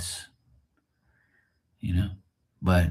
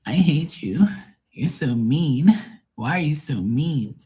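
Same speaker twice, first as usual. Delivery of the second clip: high, drawn-out whiny complaint; mock whining; 7 semitones higher, slower than usual. Imitating an upset viewer scolding the host, mock whining. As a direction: whine it in a high, swooping voice. Mocks a woman leaving upset.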